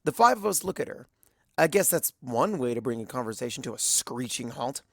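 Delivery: plain reading voice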